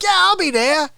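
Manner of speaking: short Artie rasp; Artie Lange. Retold or imitated. Comedian giving a short Artie Lange moment. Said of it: "little small moments of him".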